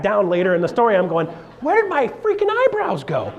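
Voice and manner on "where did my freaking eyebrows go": surprised. Retold or imitated. Retold surprise at his eyebrows.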